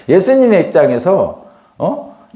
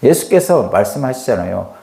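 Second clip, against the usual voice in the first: ordinary talk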